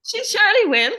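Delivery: co-host copies her in a bad Scottish accent; mock accent. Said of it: "I don't know what accent you would do".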